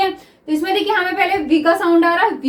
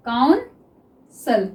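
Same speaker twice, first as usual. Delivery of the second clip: Officer drawn out very slowly; teacher dictating officer to students; slow dictation; 4 semitones lower, much slower than usual. Shown as a demonstration. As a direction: stretch officer as if writing it down. Dictating while writing shorthand.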